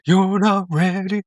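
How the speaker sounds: high, slow theatrical delivery; sing-song it like a musical diva; dramatic musical voice. Retold or imitated. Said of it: "That was pretty good".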